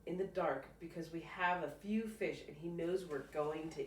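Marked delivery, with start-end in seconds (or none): none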